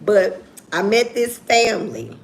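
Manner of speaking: ordinary delivery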